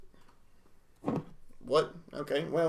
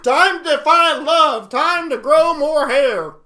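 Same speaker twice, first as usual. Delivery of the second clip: slow, higher and louder frog's voice; 7 semitones higher, 8 dB louder, slower than usual. Pretend. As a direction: play the frog getting ready to find love. Voicing the frog's mating thoughts.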